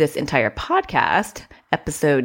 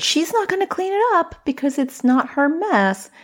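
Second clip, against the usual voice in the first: put-on higher kid's inner voice; light child voice, pitched up; child thinking aloud; 12 semitones higher than usual. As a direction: voice the child puzzling it out.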